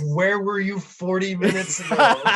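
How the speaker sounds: loud, high, laughing retort; laughing exasperation. Retold or imitated. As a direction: laugh it out in disbelief.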